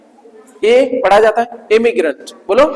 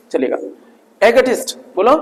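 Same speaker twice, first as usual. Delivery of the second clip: normal talking voice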